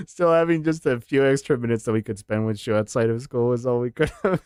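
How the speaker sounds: usual speaking voice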